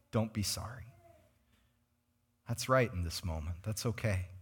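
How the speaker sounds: soft, low and comforting; gentle reassurance. Retold or imitated. Pastor consoling a crying person at a deathbed, tender. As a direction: soothe them quietly, leaving pauses.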